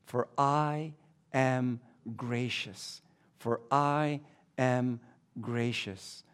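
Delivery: slow, soft, lingering "For I am gracious"; soft emphasis. Shown as a demonstration. Pastor stressing God's grace to the congregation, slow and soft. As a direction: hush it so they don't miss it. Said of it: "let's not miss that".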